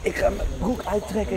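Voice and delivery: usual speaking voice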